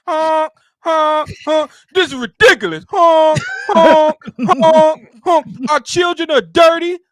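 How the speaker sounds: high, loud mock bullhorn protester; silly clown. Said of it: "whoever had that bullhorn".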